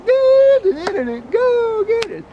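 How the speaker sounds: long high shouted cheer, drawn out slow; stretched stadium yell; team cheer. Acted. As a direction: roar it for the Gators.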